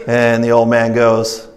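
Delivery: normal talking voice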